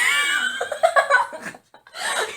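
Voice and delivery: high shrieking laugh; loud burst of laughter. Real, not acted. Big laugh at joke.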